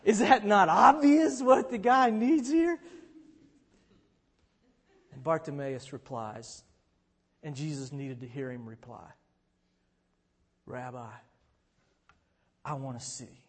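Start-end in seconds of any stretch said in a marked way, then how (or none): 0.0-2.8 incredulous. Real, not acted. Incredulous aside. 10.7-13.4 humble quiet plea. Retold or imitated. Bartimaeus quietly answers.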